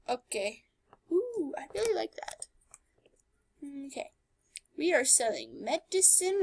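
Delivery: ordinary talk, nothing marked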